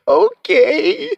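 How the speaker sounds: put-on high stretched-out little-boy whine; tearful toddler. Dad mimicking his son's sad answer, high mock-crying voice. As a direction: mimic a child conceding through tears. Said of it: "That's literally what he said".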